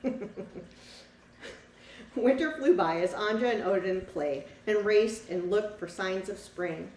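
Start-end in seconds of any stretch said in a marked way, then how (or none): 2.2-6.9 lively storybook read-aloud for a class; reading to children. A narrator's reading. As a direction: tell the story to the children, bright and clear. Teacher reads picture book aloud.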